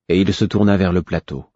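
ordinary delivery

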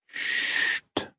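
soft unvoiced shh; whispered shush. A narrator's reading. Burrick hushing the crying baby, whispered. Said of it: "lui disait-il".

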